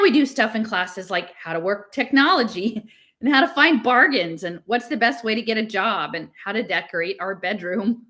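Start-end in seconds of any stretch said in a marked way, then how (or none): none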